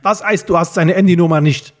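put-on older man at the end of his patience; losing his temper. A narrator's reading. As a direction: call it out, about to lose it. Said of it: "der langsam die Beherrschung verlor".

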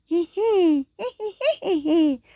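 tiny high-pitched giggle. Acted. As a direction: giggle like a little ball. Ball's giggle voice. (hohes Kichern)

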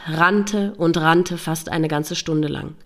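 ordinary delivery

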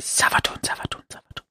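whispered spooky chant; eerie whisper. Pretend. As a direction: hiss the name like a ghost. Eerie chanted whisper of a villain name.

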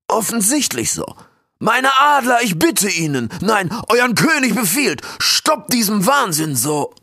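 high, loud commanding king voice; booming warrior king. Acted. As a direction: call your eagles to halt with authority. King commands his eagles.

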